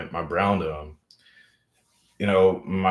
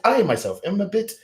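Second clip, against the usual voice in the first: much higher, prissy upper-class British voice; mock posh British accent; 11 semitones higher than usual. Mocking how Americans hear a British accent, high posh British voice. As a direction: play a pompous English toff. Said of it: "using your Brit accent".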